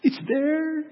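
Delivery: very high, drawn-out little voice; tiny high voice. Acted. Preacher evokes tiny water under traffic, in a high little voice. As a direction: pitch it way up, small and sustained.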